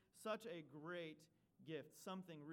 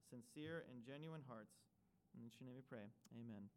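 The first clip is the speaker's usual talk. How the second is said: prayer; 3 semitones lower than usual. Acted. Pastor leads prayer.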